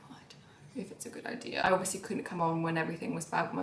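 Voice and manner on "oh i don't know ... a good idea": soft unvoiced whisper, hesitant; whispered self-doubt. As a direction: breathe it as a worried aside. Voicing her own doubt.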